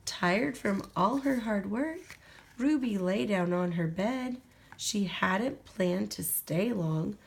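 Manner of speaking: ordinary delivery, nothing marked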